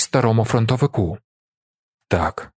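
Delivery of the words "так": low, subdued assent; saddened. A narrator's reading. Kozole answering his old comrade, saddened and low. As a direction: let the word drop low. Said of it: "засмучено говорить Козоле".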